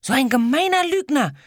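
put-on girl yells at the bully, high and loud; indignant scream. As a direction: shriek in outrage at the liar. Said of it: "schrie das kleine Mädchen empört".

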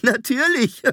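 put-on high, boyish, breaking into laughter; tickled, high-pitched reply; amused exclamation. A narrator's reading. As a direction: answer merrily, laugh following. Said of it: "brach wieder in sein ansteckendes Lachen".

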